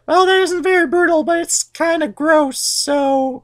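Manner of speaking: very high falsetto, slowing grudging okay; Rhea grudgingly. Retold or imitated. Rhea conceding to Zeus, falsetto, slowing. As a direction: shrug and concede. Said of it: "And Rhea's like".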